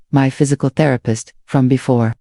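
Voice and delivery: ordinary delivery, nothing marked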